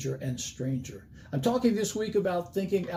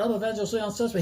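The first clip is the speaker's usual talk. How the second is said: put-on fast and higher, the name blurred in a Southern accent; fast southern drawl; 7 semitones higher, faster than usual. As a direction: blurt the name out too quick to understand. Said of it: "talked really fast back in those days and had a southern accent".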